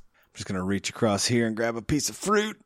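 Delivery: ordinary talk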